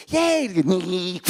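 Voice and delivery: put-on child's squealed cheer; excited little kid. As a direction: squeal with glee like a small boy. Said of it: "He's like a total spaz".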